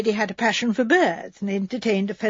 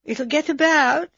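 dismal maid; 6 semitones higher, slower than usual. Acted. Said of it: "said Edna dismally".

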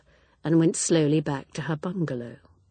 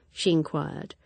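plain reading voice

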